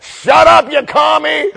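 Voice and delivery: high, very loud comic retort; mock yelling back. Unmasked churchgoer to the mask scolder, mock furious yell. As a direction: bellow it back in hammed-up outrage. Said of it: "literally yelling at other people".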